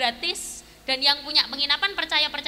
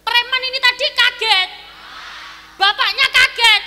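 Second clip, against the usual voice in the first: louder and higher on each line, ending in a yell; building shout; 8 semitones higher, 10 dB louder than usual. Shown as a demonstration. Preacher acts the shock, building to a high loud yell. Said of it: "kalau dengar saya bilang kaget".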